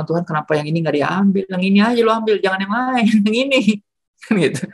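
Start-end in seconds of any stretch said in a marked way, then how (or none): 0.0-3.6 higher, pleading complaint ending in a laugh; mock protest to God. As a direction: haggle with God like a whiny kid. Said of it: "Gue bilang".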